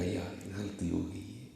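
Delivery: soft, choked-up apology; hushed, half-crying plea for forgiveness; barely voiced, weepy sorry; retold tearful plea. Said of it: "رو کر کہنے لگا".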